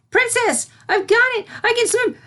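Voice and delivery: high, loud cry of joy; thrilled shout. A narrator's reading. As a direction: shout it like a kid who just learned to swim. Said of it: "face beaming".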